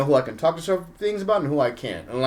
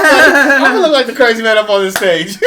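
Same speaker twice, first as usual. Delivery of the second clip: louder, laughing through the line; 6 semitones higher, 9 dB louder than usual. Real, not acted. Retelling his Hollywood set to a fellow comic, laughing. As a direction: crack up while telling it. Laughing at own retold bit.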